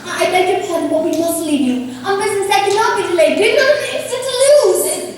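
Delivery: pressing haste building to alarm; urgent alarm. Acted. Young woman telling Darcy she must go, urgent alarm.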